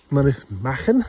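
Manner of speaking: ordinary talk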